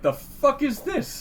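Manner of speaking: high, slow incredulous voice; a barman squinting at a credit card; mock bar staff. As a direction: sound baffled at the card. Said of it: "they're like".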